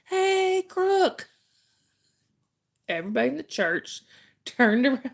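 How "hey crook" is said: high-pitched called-out holler; mock yell. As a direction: imitate dad yelling across a church. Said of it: "He just yelled out".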